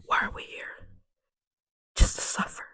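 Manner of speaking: very soft, voiceless whisper. Acted. Lamenting to oneself after ranting, hushed whisper.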